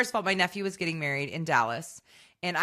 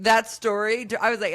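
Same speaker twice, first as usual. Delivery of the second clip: ordinary delivery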